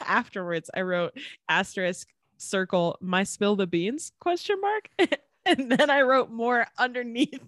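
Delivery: ordinary talk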